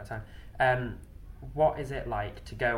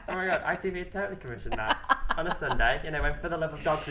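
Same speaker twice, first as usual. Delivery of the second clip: laughing through the words, fast and higher; 5 semitones higher, 4 dB louder than usual. Real, not acted. Host laughs while pitching the idea to her guest. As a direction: giggle as you gush over the idea.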